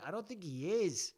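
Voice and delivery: ordinary delivery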